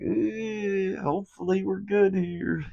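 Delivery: high-pitched, drawn-out "eh" of doubt; mock uneasy hope. Acting out his uneasy hope while driving.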